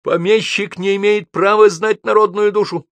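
high, loud tirade; angry shouting. A narrator's reading. Гоголь berates Тургенев about his writing, shouting. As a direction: keep yelling the accusation. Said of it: "закричал он Тургенева".

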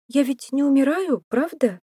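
high, anxious woman's voice; worried question pitched well up; alarmed. A narrator's reading. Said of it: "встревожилась Беатрис Джоанна".

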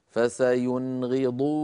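slowly recited "فسينغضون", short noon straight into ghayn, long final oo; clear izhar. Shown as a demonstration. Teacher showing noon before ghayn, "فسينغضون" in slow clear izhar. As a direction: chant "فسينغضون" without lengthening the first noon. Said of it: "لا زيادة في زمن النون".

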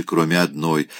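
plain reading voice

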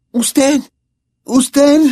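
Hermione accusing. A narrator's reading. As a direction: point at him and stammer the word.

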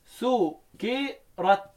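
سكرت drawn out slowly as "su-ki-rat"; syllable by syllable, single k in سكرت; light k in سكرت. Shown as a demonstration. Teacher answers how it is read lightly, slow light-k سكرت. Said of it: "مين اللي قرأها خفيف؟".

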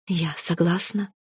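soft, slow whispered yes. Acted. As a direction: barely breathe the consent. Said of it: "прошептала".

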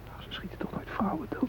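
hushed, breathy whisper so the gunmen can't hear. Acted. Hostage whispers to a fellow hostage, in a whisper. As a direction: keep it to a frightened whisper.

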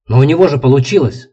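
loud, high defensive outburst; blurted defensively. Acted. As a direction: blurt it out, justifying yourself. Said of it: "выпалил Данила, оправдываясь".

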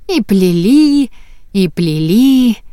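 each "и плели" stretched slowly; slow drawn-out repetition. A narrator's reading. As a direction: draw out "и плели", lingering on every word. Repeated braiding phrase.

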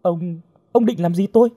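high, stammering alarm; panicked question. A narrator's reading. Girl facing a knife held close, panicked question. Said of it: "Hồng hoảng hốt".

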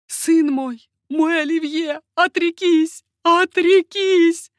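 high, loud, desperate appeal; mother's imploring cry. A narrator's reading. Mother begging her son to renounce, crying out. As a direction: call out high, building in force. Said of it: "взывала к нему мать".

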